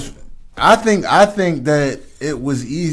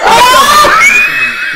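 big high burst of real laughter, much louder; loud laughing fit; 9 dB louder than usual. Real, not acted. Loud laughing fit at his friend's joke. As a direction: crack up loudly.

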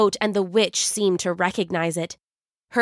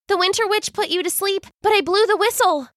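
put-on excited girl; 11 semitones higher, faster than usual. Silva eagerly explains.

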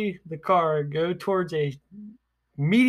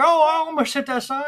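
high, fast and a bit louder; imitating the old driver; 11 semitones higher, 6 dB louder, faster than usual. Friend imitates the teacher noticing the sign, high and fast. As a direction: play the dazed driver. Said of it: "He would be like".

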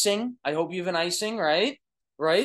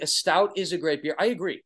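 ordinary delivery

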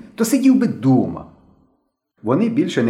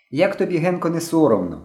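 higher-pitched mild scolding; reproachful; 7 semitones higher than usual. A narrator's reading. Slavik scolding Henka for breaking their agreement, reproachful. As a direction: reproach a friend, falling away at the end. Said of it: "докоряв йому Славик".

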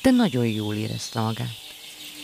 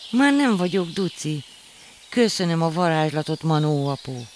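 much higher, happy thanks; joyful; 6 semitones higher, 4 dB louder than usual. A narrator's reading. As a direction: bounce with joy as you thank. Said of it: "ugrándozott boldogan". (boldog ujjongás)

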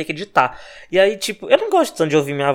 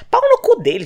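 sudden high curse spat out; angry outburst; 4 dB louder than usual. Real, not acted. Podcaster cursing the president's son, an angry high outburst. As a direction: blurt the insult up high in disgust. Ranting about Bolsonaro's son.

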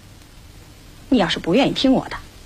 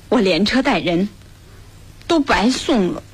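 usual speaking voice

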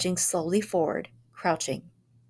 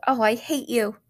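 put-on angry child; 5 semitones higher, 4 dB louder than usual. A narrator's reading. Boy shouts at father.

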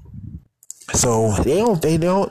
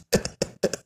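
helpless high-pitched giggle; real laughter; 8 dB quieter than usual. Real, not acted. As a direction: break up laughing at your own story. Laughs at his own retelling.

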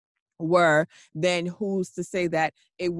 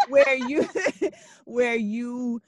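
laughing through the words; words broken by a laugh; 7 semitones higher, 5 dB louder, much slower than usual. Real, not acted. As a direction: giggle it out.

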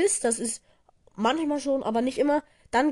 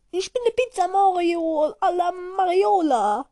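pizza man voice; 7 semitones higher, 4 dB louder than usual. Acted.

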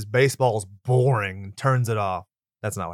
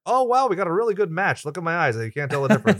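mock cheap producer; 6 semitones higher, 4 dB louder, faster than usual. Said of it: "cheaping out and be like".

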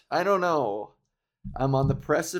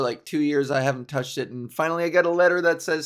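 ordinary talk

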